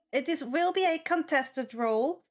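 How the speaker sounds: ordinary talk, nothing marked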